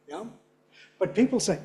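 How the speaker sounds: ordinary talk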